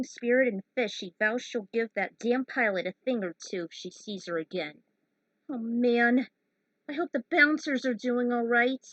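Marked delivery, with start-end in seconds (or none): 5.5-8.8 worried exclamation. Acted. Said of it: "she said out loud".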